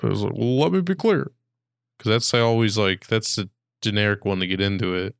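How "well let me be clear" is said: higher, measured Obama voice; a hint of Obama's podium cadence; Obama impression. Podcaster demonstrating his generic Obama line. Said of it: "my Obama impression".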